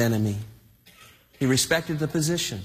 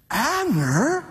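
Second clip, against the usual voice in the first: high, rising name call; acted high-pitched query; questioning call; 10 semitones higher than usual. Onlookers calling on embarrassed Abner.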